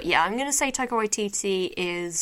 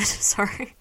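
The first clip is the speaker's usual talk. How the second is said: normal talking voice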